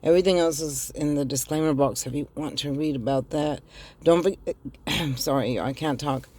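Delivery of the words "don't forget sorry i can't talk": ordinary delivery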